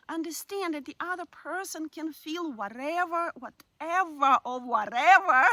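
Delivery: put-on Russian accent swelling into loud, dismissive whatevers. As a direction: toss off each whatever in a hammed-up Russian accent. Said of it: "This is kind of like nice Russian, um, uh, accent".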